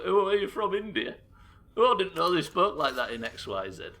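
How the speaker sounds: high, loud put-on surprise, dropping at the end; mock clueless joker. Somebody teasing an attempted accent, mock surprise. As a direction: mimic a tired accent joke loudly. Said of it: "somebody says".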